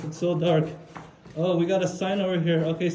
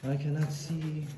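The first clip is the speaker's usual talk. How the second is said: ordinary delivery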